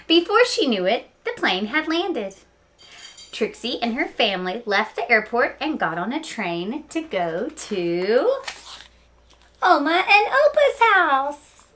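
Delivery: lively read-aloud storytelling; story narration. Acted. Teacher reading Knuffle Bunny to her students, storytelling voice. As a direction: tell it like story time for kids.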